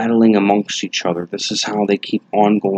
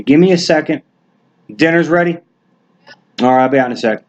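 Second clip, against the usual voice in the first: higher, louder call to another room; yelling across the room; 6 semitones higher, 4 dB louder, much faster than usual. Real, not acted. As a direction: call out to someone offscreen. Answers family calling him to dinner.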